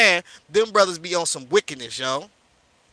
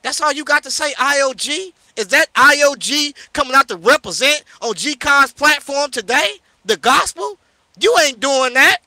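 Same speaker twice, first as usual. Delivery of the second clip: higher, louder outrage; outraged; 5 semitones higher, 4 dB louder than usual. Real, not acted. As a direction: fire back higher and louder. Outraged reply to remark.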